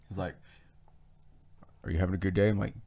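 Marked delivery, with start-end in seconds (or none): 1.8-2.5 low creepy small talk; scary neighbor voice. Retold or imitated. Imitating the neighbor checking in, low and creepy. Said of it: "he talks like this".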